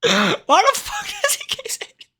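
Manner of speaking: high incredulous question; disbelieving squeal. Real, not acted. Streamer reacts to repetition.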